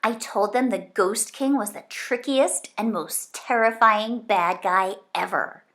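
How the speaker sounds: ordinary delivery, nothing marked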